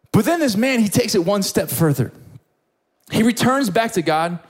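normal talking voice

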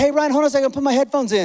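lightly hurried, asking a friend to hold on; mildly urgent. Retold or imitated. Retold hurried phone interruption.

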